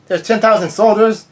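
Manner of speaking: can't believe the number, asked with a pitch jump; genuinely taken aback at the figure; surprised. Real, not acted. Viewer's own astonished reaction.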